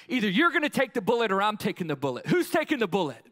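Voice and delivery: loud, high, fast confrontation; exploding right back. Acted. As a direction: fire it straight back in his face. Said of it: "I explode right back in his face".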